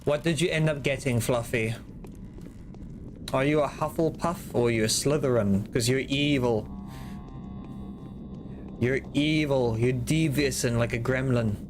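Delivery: usual speaking voice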